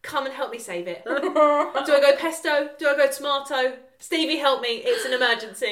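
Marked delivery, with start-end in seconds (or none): 1.0-5.7 panicked. Acted. Panicked cooking dilemma, high loud.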